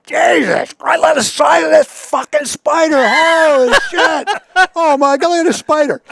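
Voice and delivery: high, loud, astonished cursing; excited yelling. Retold or imitated. Ted yelling at the dugout spider, high loud astonished cursing. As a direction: belt it out like a man shocked by a huge spider. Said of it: "And Ted's going".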